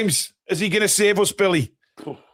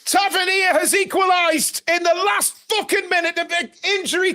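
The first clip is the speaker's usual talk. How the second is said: higher, exasperated shouted rant; frustrated shouting; 7 semitones higher than usual. Real, not acted. Fan ranting to co-host about lost lead, exasperated shout. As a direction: vent at the club for blowing another lead. Ranting after conceding late.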